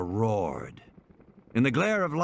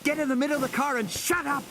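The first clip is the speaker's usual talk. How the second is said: put-on urgent boy; 13 semitones higher than usual. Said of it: "He turned to Lex".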